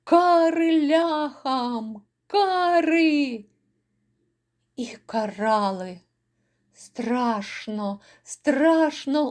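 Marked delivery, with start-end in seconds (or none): 0.0-3.4 slow, drawn-out war cry, higher; shouting. A narrator's reading. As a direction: call out vengeance across the market. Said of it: "і обидва закричали".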